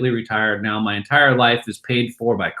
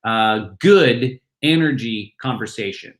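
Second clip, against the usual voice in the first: ordinary talk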